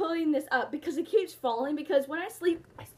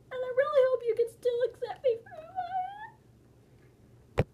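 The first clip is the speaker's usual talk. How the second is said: hammed-up tearful plea with sobs; fake-sob through it, pleading; mock crying plea; 7 semitones higher than usual. Begging viewers to accept her as a tiger, mock crying.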